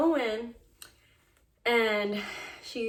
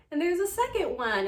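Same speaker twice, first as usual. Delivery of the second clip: high and louder, bright announcement; excited reveal; 10 semitones higher, 6 dB louder than usual. Retold or imitated. Doctor telling couple about a second baby, excited. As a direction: break the surprise news.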